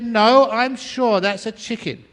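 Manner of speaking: high, slow mock insistence; insistent wife. Wife contradicts husband about the bird, high and insistent. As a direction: dig in, drawing the words out high. Acted couple's escalating argument.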